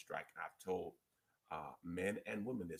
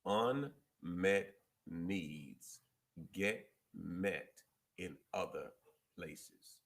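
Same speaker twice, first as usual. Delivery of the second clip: very slow, a pause after each phrase; real slow; much slower than usual. Shown as a demonstration. Speaker repeating the point to the listeners, real slow. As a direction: spell it out word group by word group. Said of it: "I'ma say that real slow".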